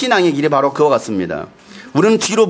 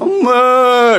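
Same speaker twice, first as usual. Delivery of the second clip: long higher moo-like wail; calf calling mother; 6 semitones higher, 4 dB louder, much slower than usual. Acted. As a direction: moo out "mama" like a crying calf. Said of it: "그래서 송아지가 그러면". (엄마를 부르는 송아지 울음소리)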